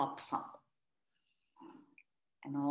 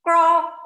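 loud, high croak of a frog; a sudden loud frog croak; 7 semitones higher, 12 dB louder than usual. A narrator's reading. Frog interrupts the class with a loud high croak. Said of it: "someone hopped in".

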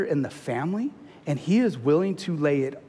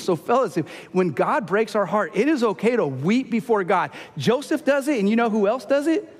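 higher-pitched fervent preaching, pushing each line upward; impassioned preaching; 7 semitones higher than usual. Acted. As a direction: preach it with rising conviction to the men. Preaching climax, pitch far up.

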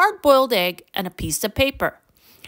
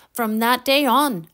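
ordinary delivery

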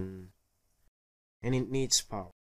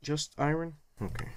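normal talking voice

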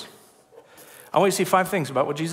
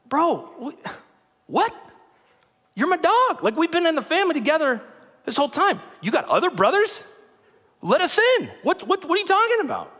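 incredulous brother; 13 semitones higher, 3 dB louder than usual. Retold or imitated. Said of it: "James and Jude are like".

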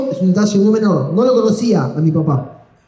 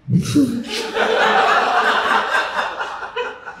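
wheezing silent laugh; a real wheeze of laughter; high breathless laugh; 5 dB quieter than usual. Real, not acted. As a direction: laugh soundlessly high in the throat. Teller laughs at the memory.

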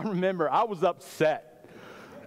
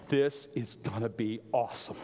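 softer and lower, a gleeful inner voice; hushed glee; 8 semitones lower, 6 dB quieter than usual. Retold or imitated. Boy watching his dad march down, hushed glee. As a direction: savour it under your breath.

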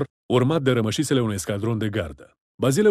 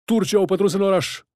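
high-pitched cry of alarm; frightened yell; 8 semitones higher, 4 dB louder than usual. A narrator's reading. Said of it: "urlă înspăimântat". (strigăt înspăimântat)

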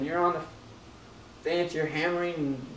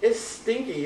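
loud, lively preaching; 5 semitones higher, 9 dB louder than usual. Acted. Preacher riffing to the congregation, loud and lively. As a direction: work up the congregation.

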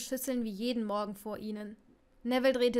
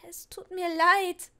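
panicked apology; 6 semitones higher, 6 dB louder, slower than usual. Acted. Neville entschuldigt sich panisch.

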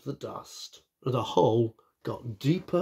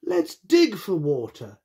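animals calling out; 6 semitones higher, 7 dB louder than usual. Acted. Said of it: "said the animals".

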